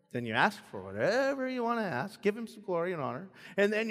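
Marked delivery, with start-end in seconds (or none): none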